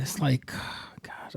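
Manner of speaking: tired, breathy aside; weary whisper. Real, not acted. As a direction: whisper it in frustration.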